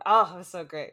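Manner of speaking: normal talking voice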